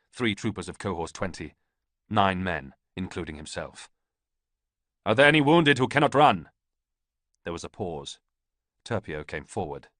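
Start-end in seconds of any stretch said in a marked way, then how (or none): 5.0-6.5 loud, higher command; calling out. A narrator's reading. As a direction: call to the men over the noise. Ballista calls to his men.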